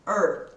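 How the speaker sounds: "Er." with the tongue curled back, said firmly; r sound demo. Shown as a demonstration. Teacher showing countryside students the r sound. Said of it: "esse som é muito fácil".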